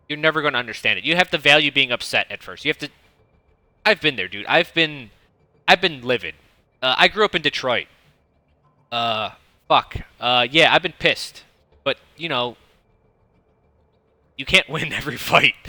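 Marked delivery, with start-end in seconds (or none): none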